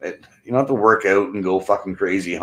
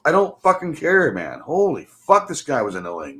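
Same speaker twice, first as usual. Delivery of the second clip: normal talking voice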